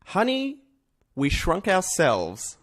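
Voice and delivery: ordinary delivery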